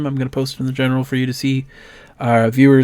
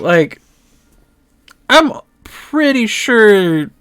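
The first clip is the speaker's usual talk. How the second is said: high, louder folksy lecture; gently scolding shopkeeper; 3 semitones higher, 4 dB louder than usual. Acted. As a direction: set them straight with a grin. GM voices the shopkeeper NPC.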